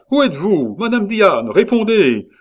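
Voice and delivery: high, urgent shouting into the night; shout, demanding an answer; loud cry. A narrator's reading. A husband searching for his wife in the dark, loud cry. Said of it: "criait cette voix".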